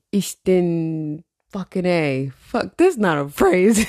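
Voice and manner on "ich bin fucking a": very slow, searching for each word; halting attempt. Real, not acted. Podcaster trying to remember scratched German, halting and unsure. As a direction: feel your way through unfamiliar German, slowly.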